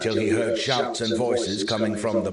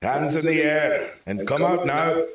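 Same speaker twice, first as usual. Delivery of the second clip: higher, commanding shout; authoritative shout; 7 semitones higher than usual. A narrator's reading. Soldier orders the hiding man out, a commanding shout. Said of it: "shouted a new voice".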